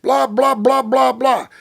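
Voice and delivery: high, loud mock of a rival griping on the radio; hammed-up whine about being knocked around; complaining radio voice.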